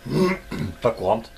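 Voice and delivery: choking mid-sentence; struggling to get words out; choking on a drink. Real, not acted. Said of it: "je m'étouffe".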